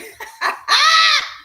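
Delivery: high, loud burst of laughter; loud cackle. Real, not acted. Host amused by a childish commenter, loud cackle.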